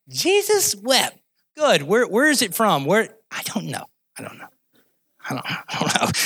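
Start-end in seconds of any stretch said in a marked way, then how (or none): 0.1-1.2 high, drawn-out, grandly declaimed recitation; boy reciting verse. Acted. Boy recites the same verse again. 3.3-6.1 very soft, low, trailing reply; sheepish mumble. Acted. Boy stumped by teacher's question.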